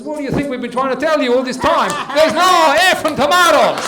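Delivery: loud, high, fed-up punchline; exasperated shout. Acted. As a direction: explode at a customer who won't understand. Exasperated shopkeeper lands the punchline.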